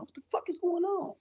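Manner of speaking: high, startled mock panic; imitating his own panicked cry; shocked outburst. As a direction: yelp it like the world just broke. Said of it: "I'm like".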